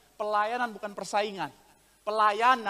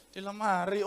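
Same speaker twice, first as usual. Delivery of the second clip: softer, understated mock answer; soft reply; 3 semitones lower, 5 dB quieter than usual. Wife answers husband's third call, soft reply. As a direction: answer too quietly to be heard. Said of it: "tapi pelan".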